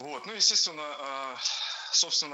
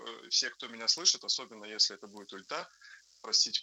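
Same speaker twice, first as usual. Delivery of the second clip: normal talking voice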